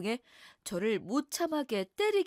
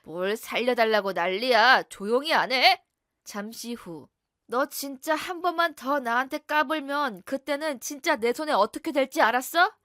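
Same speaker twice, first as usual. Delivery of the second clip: loud, menacing husband barking a dare; angry husband; 7 dB louder than usual. Acted. Abusive husband bullying his wife, loud threat. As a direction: dare her to talk back, loud and hostile.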